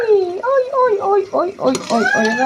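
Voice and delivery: repeated high "آي" yelps fading down; real pain cries. Real, not acted. Mother scalded by spitting syrup, yelping in pain. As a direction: cry out from the sting, then settle.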